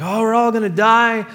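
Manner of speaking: high, loud mock panic; freaking-out sailors. Sailors in a storm threatening to break up the boat, mock panic. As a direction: cry out in hammed-up alarm. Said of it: "the sailors are freaking out".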